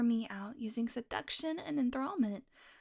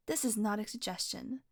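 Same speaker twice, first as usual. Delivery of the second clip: normal talking voice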